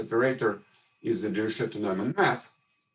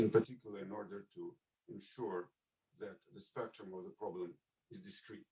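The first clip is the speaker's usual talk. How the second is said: drops to near silence mid-sentence; breaking up on a bad connection; voice coming and going; 2 semitones lower, 16 dB quieter than usual. Real, not acted. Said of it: "your voice is coming and going".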